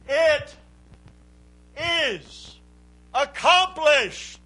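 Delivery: high, slow cry; Jesus' last cry from the cross, high and slow; dying words. Retold or imitated. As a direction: lift it up high and stretch each word out.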